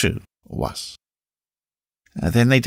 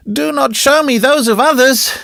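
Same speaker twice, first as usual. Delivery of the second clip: high, strained outburst; sudden anguished exclamation; 5 dB louder than usual. A narrator's reading. Recluse begging not to see other children, anguished high exclamation. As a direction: cry it out suddenly, pitched far up. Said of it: "she suddenly exclaimed".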